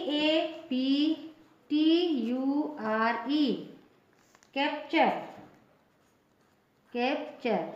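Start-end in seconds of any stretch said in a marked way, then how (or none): 4.6-7.7 कैप्चर in two parts, "kep-chur"; T read as CH. Shown as a demonstration. Teacher modelling the URE rule for learners, कैप्चर with "ch". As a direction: say कैप्चर with "ch" after the p. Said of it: "टी का उच्चारण च".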